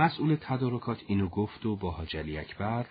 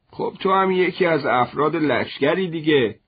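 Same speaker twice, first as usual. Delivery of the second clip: higher, louder insisting; insistent; 6 semitones higher, 6 dB louder than usual. Acted. Father insists his commander son take the sneakers, pushing louder. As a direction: press the point home.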